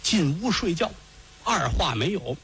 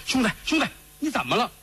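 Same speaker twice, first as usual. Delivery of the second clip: alarmed brother; 8 semitones higher, faster than usual. Acted.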